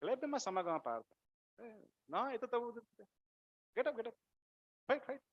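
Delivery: light, offhand urging; unbothered prodding in short bursts; very casual. Acted. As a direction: wave away his tears, softly and casually. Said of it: "Very casually".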